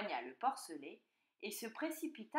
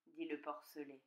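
plain reading voice

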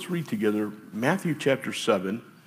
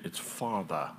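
father said in a soft British accent, lower and quiet; 4 semitones lower, 7 dB quieter, slower than usual. Shown as a demonstration. Pastor jokes to the church in a British accent. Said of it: "I slip into my British accent".

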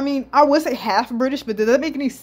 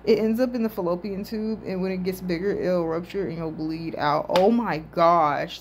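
ordinary delivery

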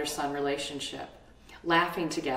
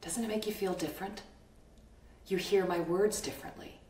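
calm guiding voice; 6 dB quieter than usual. Acted. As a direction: lead them gently into calm. Guides listeners to calm breathing.

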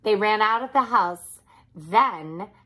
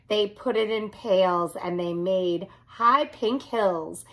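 ordinary delivery, nothing marked

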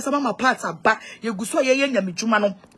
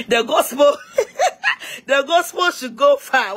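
higher, louder repeat broken by real laughter; tickled by your own phrase, pitched up and laughing; amused repeat; 4 semitones higher, 4 dB louder, slower than usual. Real, not acted. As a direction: laugh through it, drawing out the final phrase.

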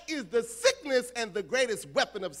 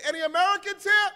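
high, loud call for Americans; eager official calling Americans forward; 8 semitones higher, 6 dB louder than usual. Retold or imitated. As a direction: call across the airport hall, louder and higher.

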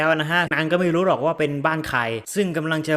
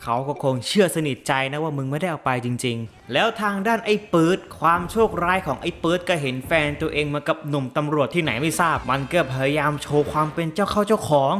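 ordinary talk

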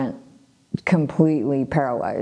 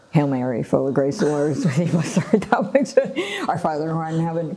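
rushed prayer; much faster than usual. Retold or imitated. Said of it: "'cause I didn't know how to pray".

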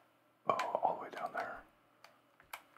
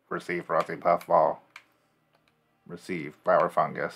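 robot voice; 7 dB louder than usual. Pretend. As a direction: read it like a machine. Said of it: "Now I sound like an evil android".

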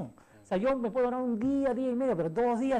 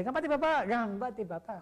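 imitated young daughter; 3 semitones higher than usual. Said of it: "Y justo Midori me dice".

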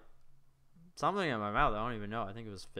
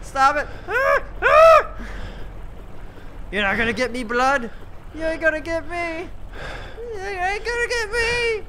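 terrified screaming; 11 dB louder than usual. Real, not acted. Chased by monster, screaming.

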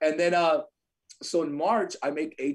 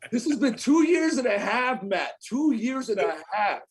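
exasperated; 7 semitones higher, 3 dB louder than usual. Retold or imitated. Exasperated at slow brother.

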